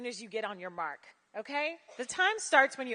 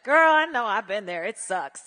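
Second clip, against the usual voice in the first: put-on loud, knowing girlfriend reply; sympathetic audience; 10 dB louder than usual. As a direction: call out in loud agreement. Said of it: "you'll be like".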